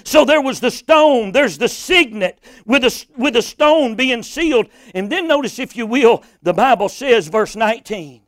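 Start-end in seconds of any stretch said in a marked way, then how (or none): none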